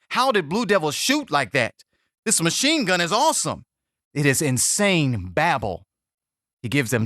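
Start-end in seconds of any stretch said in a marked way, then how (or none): 0.1-3.6 higher-pitched, mildly awed raving; excited raving. Acted. Soldiers marvelling after Blue Devil's assault, excited raving. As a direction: rave like soldiers amazed by what they saw. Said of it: "They rave about the assault".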